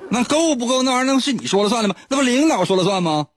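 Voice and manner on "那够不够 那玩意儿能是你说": laughing. Real, not acted. Mocking while laughing.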